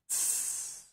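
a snake-like tss; hissing ts. Shown as a demonstration. Teacher shows learners the German Z sound with a snake hiss. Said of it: "like a snake".

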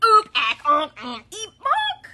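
loud, high jumble of bird yelps; bonked flock, squawk after squawk; pile-up squawks. Acted. Flock all bumping together.

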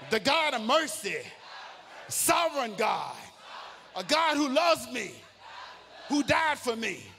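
full-force preaching. Acted. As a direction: preach it out to the rafters.

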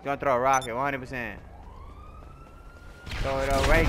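ordinary talk, nothing marked

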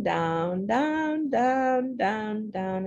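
slow, drawn-out, fading away; slow chant of down. Shown as a demonstration. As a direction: let each down sink and fade.

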